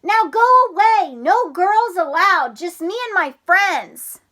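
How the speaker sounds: high, loud dismissive shout; cry. Acted. Froggy shouting his little sister away, loud. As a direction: brush her off with a shout.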